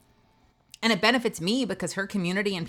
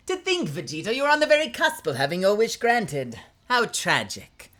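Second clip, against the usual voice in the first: a put-on alien tyrant gloating at Vegeta; Frieza voice; 5 semitones higher than usual. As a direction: savour the enemy's despair. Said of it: "Frieza laugh".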